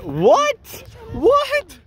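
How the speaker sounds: high loud disbelieving what; shocked. Real, not acted. Shocked reaction.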